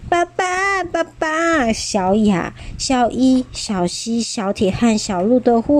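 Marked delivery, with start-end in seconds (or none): 0.1-1.7 激动呼喊. Acted. 宝宝们呼喊爸爸.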